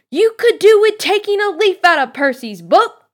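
high, very loud scolding yell; shrieked telling-off. A narrator's reading. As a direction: yell it like a fed-up mother. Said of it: "yelled Missus Weasley".

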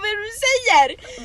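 high, loud mock disbelief; shocked outcry. Friend re-enacts her reply to the guy, high shocked shriek. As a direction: cry it out as if appalled.

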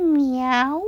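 high little meow; kitten meow. A narrator's reading. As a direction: miaow like a small kitten. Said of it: "nyávogott a kis cica".

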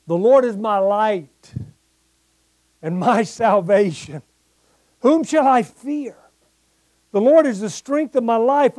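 loud, ringing psalm proclamation; preaching scripture reading. Acted. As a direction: proclaim each verse to the congregation. Reads psalm with preaching force.

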